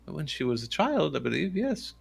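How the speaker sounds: put-on accented, lilting cleric's voice; hesitant priest. Priest recalls the lady's rare church visits, accented lilting voice. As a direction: answer carefully as an old-world priest. Game master voices Lucian answering.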